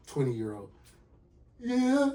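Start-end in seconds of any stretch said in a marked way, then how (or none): 1.6-2.1 high, drawn-out mock tearful voice; crying girlfriend. Girlfriend confesses to him on the phone, imitated crying. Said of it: "as she's crying on the phone".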